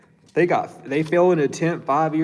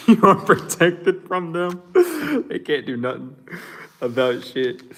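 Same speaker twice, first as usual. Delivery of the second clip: laughing reassurance; 6 semitones higher, 5 dB louder than usual. Real, not acted. Reader to viewer about the baby mama, laughing. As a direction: laugh it off while promising protection.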